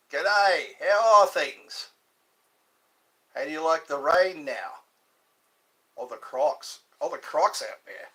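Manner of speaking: hearty put-on Australian accent; Aussie accent. Shown as a demonstration. Trying to pass as Aussie at a pub, Australian accent. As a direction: greet them like an Aussie at the pub. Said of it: "would I be a convincing Aussie".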